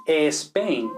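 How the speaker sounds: Spain as "Espain"; added initial E. Shown as a demonstration. Teacher shows Spaniards' error, Spain as Espain. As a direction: put a clear E before Spain. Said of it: "en inglés no hay una E".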